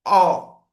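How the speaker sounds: put-on a loud, clear "O" for the child; vowel sound O. Shown as a demonstration. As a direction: sound out the "O" boldly. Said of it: "o O".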